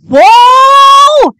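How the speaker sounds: very high, loud shocked shout; a real yelp of amazement; shocked whoa. Real, not acted. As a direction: blurt out in disbelief. Reacting to big news.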